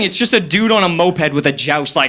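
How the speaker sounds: ordinary delivery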